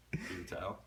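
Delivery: normal talking voice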